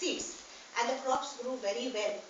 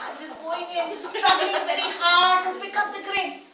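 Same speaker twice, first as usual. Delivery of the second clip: chuckling while describing the tiny locust; laughing through the tale; 4 semitones higher, 10 dB louder than usual. Acted. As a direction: laugh as the little locust strains. Storyteller voicing the struggling locust.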